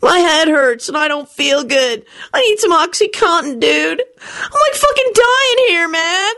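high, drawn-out whine; whiny moaning. A narrator's reading. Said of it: "moaned Kenny".

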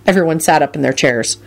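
ordinary delivery

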